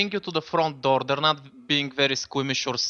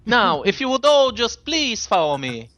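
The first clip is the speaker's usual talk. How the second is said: pitched up and louder, polite usher; courteous robot host; 5 semitones higher, 5 dB louder than usual. Acted. Robot asks the guests to follow, politely insistent role voice. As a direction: usher them along as a formal robot.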